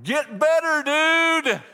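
high, loud smart-aleck holler; mock kid yell. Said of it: "smart aleck little kid that yells down".

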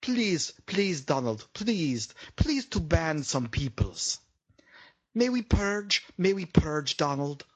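put-on pleading, broken-English Eastern European accent; mock Eastern European accent, imploring; Melania impression. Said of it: "That's my malaria imi-, uh, imitation".

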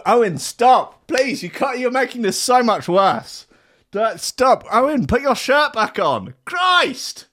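high, hammed-up plea to stop; mock pleading. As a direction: beg him to stop, overacted.

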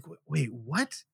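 retold puzzlement, dropped in volume; baffled. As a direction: act out a quiet, confused "wait, what". Manager puzzled by the name.

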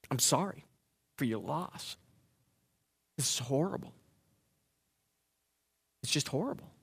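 soft, hushed sympathy; hushed condolence. Retold or imitated. Pastor softly consoling a family who lost everything. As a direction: murmur it gently to the bereaved.

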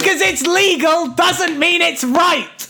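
high, emphatic, exasperated rant; worked-up moral objection; indignant lecture. Real, not acted. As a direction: lecture them in high-pitched outrage. Mock-serious lecturing co-host about legality.

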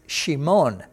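Shimon with sh, shee-MON; Hebrew pronunciation. Shown as a demonstration. Teacher building the name from its rule, Hebrew pronunciation. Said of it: "you'll pronounce it with that sh sound".

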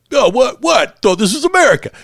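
high, loud indignant dad whine; Randy-style outrage. Retold or imitated. Fan quoting Randy Marsh's favorite bit, high indignant whine. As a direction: squawk it like an outraged cartoon dad. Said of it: "All the stuff with, you know, like".

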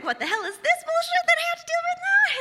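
high, squeaky mock outrage; exasperated disbelief. Soaked on the walk, complaining to herself, exasperated. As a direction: whine at the unfair rain. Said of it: "I'm like".

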